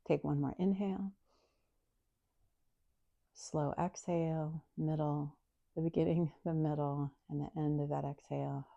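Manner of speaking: hushed meditation instructor; soft guided meditation. Acted. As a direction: guide them softly through the breath.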